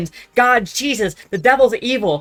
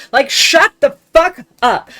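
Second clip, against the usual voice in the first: loud, higher, slow outraged yell; angry shout; 5 semitones higher, 5 dB louder, slower than usual. Real, not acted. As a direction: drag it out in fury. Outraged at the lyric.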